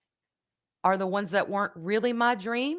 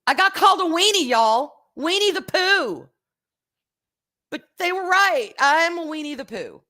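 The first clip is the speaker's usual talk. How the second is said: amused outburst; 8 semitones higher, 8 dB louder than usual. Real, not acted. Laughing at being called weenie.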